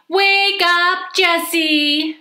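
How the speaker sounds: high, loud singsong wake-up; piercingly cheerful morning holler; too loud wake-up call. A narrator's reading. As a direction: call out too loudly to rouse a sleeper. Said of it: "she said too loudly".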